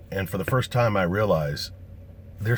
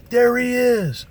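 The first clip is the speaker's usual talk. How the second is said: much higher, louder shout; yelling across the street; 8 dB louder, slower than usual. Retold or imitated. Fan spotting him across the road, shouting high and loud. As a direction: shout across four lanes of traffic.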